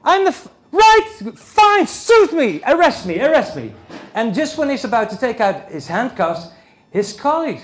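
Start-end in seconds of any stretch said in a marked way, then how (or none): 0.0-3.7 high, loud exasperated yell; shouting at the police to arrest him; outraged outburst. Retold or imitated. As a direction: throw your hands up, arrest me. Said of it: "I said".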